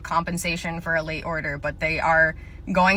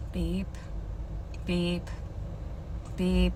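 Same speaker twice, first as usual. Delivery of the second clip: short, soft, lower mouth beep like a barcode scanner; 3 semitones lower, 6 dB quieter, much slower than usual. Acted. Clerk scanning a customer's items, mouth-made scanner beep. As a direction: imitate the register scanning items.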